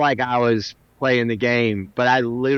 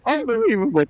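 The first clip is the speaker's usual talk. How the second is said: exasperated photographer; 10 semitones higher than usual. Retold or imitated. Photographer giving up on the singer's giant head, mock disbelief. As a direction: groan it like a beaten photographer. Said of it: "And then".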